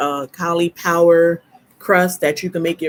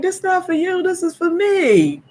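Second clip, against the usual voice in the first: much higher, sassy; high sassy retort; 13 semitones higher than usual. Retold or imitated. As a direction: toss it back high and sassy. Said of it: "I'm like".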